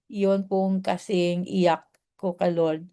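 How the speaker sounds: ordinary talk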